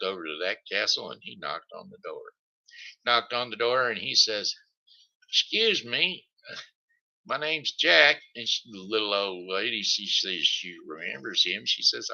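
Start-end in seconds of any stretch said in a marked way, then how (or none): 5.3-8.2 put-on higher, polite young voice; a light boyish greeting; polite Jack. Jack greets at castle door.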